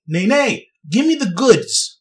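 normal talking voice

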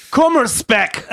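angry German. Shown as a demonstration. Correcting a friend by saying Kummerspeck angrily. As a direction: spit out Kummerspeck with mock German fury. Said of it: "You have to say it angry".

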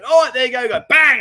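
high, loud retelling bursting into a bang; animated re-enactment. Retold or imitated. Streamer re-enacting the power pole exploding, loud and animated. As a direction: build to a sudden shouted bang.

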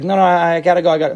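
mock a guy trying to escape; reluctant friend backing away, in a hurry; brushing off. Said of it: "Guy's like".

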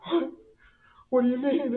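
high, tearful mimicry of mom; imitated crying. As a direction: act out a mother starting to cry. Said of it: "And she starts crying".

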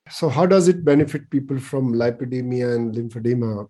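usual speaking voice

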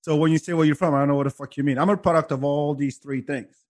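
normal talking voice

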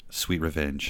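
ordinary talk

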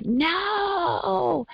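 retold scream.

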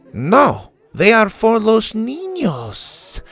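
high, slow firm refusal with Spanish lilt; Mexican accent. A narrator's reading. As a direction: shut him down sweetly but firmly. Dora refuses Dante.

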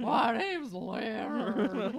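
very slow, drawn-out hound voice; bloodhound voice. Pretend. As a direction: drag the words like a droopy bloodhound. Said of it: "You have to say it in a bloodhound voice".

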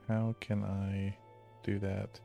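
ordinary talk, nothing marked

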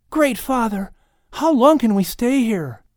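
put-on high, eager child's question; a boy pointing at a drawing; young Lamech asking Adam about a drawing, high and curious. A narrator's reading. Lamech asks in the cave.

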